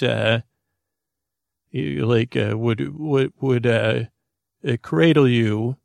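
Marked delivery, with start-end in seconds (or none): none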